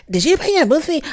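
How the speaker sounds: high, pointed mock of his question; mimicking a condescending demand; accusing mock question. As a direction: throw it at her as if she should know. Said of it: "As if she should have already known".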